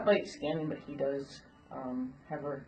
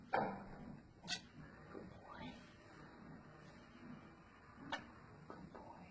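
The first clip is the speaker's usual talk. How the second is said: whispered praise; 17 dB quieter, slower than usual. Real, not acted. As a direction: breathe the praise, barely voiced. Softly praising the horse.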